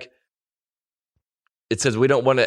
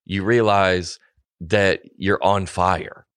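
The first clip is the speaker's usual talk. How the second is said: very slow, deadpan emphasis; slow it way down to land the joke; slow emphatic; 2 semitones lower, much slower than usual. Real, not acted. Slowed for comic emphasis.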